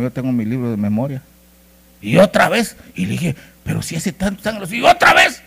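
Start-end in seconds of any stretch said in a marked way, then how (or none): 2.0-2.7 loud, high indignant question; retold outraged disbelief; incredulous. Indignant at repeated money request. 3.6-5.4 high protest rising to loud; retold exasperation, climbing at the end; indignant disbelief. Indignant at repeated money request.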